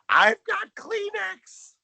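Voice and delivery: high, loud, drawn-out mock scream; imitated scream. [name] bizarrely solving the sweating crisis, mock screaming. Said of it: "by screaming".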